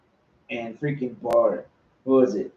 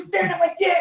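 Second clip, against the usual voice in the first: high yelled movie line; movie quote shout; faster than usual. Retold or imitated. Quoting the movie scene to his podcast host, high mock shout. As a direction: yell it like the angry guy in the film. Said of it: "You hear that part".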